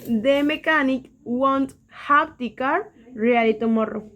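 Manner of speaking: slow English example read. Shown as a demonstration. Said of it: "Tengo esta oración que es".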